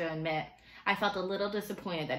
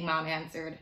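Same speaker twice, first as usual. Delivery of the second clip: plain reading voice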